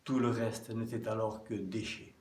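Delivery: measured poem reading, phrase by phrase with pauses; poem recitation. Acted. Speaker reads listeners an anonymous Holy Week poem, measured recitation. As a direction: recite it solemnly, stressing the openings of verses.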